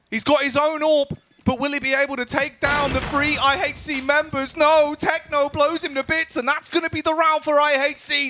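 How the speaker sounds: high, loud hype shout; shouting caster. Real, not acted. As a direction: scream the big play.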